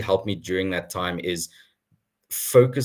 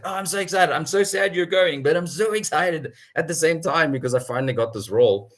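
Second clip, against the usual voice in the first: higher, bubbly excited gushing; 8 semitones higher than usual. Retold or imitated. Scene: telling a leaving colleague she finally got the role, excited. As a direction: gush with happy excitement. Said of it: "I was so excited".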